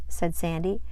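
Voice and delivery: usual speaking voice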